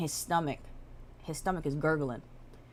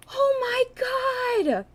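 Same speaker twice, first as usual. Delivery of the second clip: mock shocked cry; 7 dB louder than usual. Girlfriend sees boyfriend after car accident, high mock shriek. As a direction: squeal it like an overwrought girlfriend.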